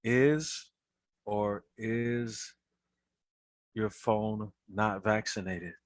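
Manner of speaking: slow deadpan. Acted. Deadpan joke punchline.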